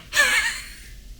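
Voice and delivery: squealing giggle up high; a burst of amused giggling; high laugh. Real, not acted. Mocking the book's characters, high giggle.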